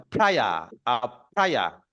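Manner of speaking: প্রায়া with no r at the end; correct pronunciation. Shown as a demonstration. Teacher to students: demo of প্রায়া. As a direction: stretch out প্রায়া, dropping the final r. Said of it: "এটা হচ্ছে".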